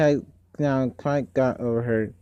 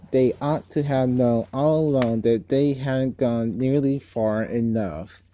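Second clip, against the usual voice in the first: usual speaking voice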